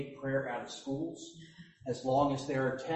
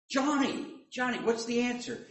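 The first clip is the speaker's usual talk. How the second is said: put-on higher, eager call of the boy's name; a teacher picking a boy out of the class; 8 semitones higher than usual. Sunday school teacher calls Johnny, eager and higher.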